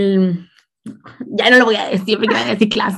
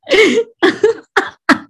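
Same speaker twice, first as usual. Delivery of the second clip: high burst of laughter; 10 semitones higher than usual. Real, not acted. Laughing at her own story.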